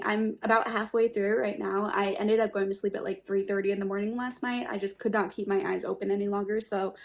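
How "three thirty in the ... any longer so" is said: ordinary delivery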